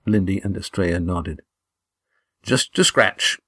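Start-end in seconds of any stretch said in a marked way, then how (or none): 2.4-3.4 proudly brushing off a wound, a bit higher. A narrator's reading. Damon dismissing his wound to friends, proudly. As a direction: make light of it with pride. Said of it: "said Damon proudly".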